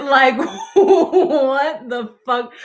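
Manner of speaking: slow, laughing through the words; laughing disbelief. Real, not acted. Cracking up with Z after the girl leaves, laughing. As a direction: crack up mid-question.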